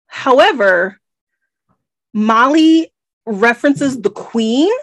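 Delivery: usual speaking voice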